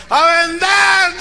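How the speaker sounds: high, long, full shout. Retold or imitated. Staff straining to please coach, loud shout. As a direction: imitate a crowd yelling its slogan slowly. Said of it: "Más fuerte".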